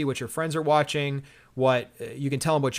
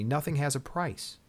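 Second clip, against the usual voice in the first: usual speaking voice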